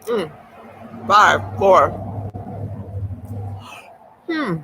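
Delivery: talking through a mouthful; chewing while counting down; mouth full. Real, not acted. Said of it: "I'm talking with my mouth full".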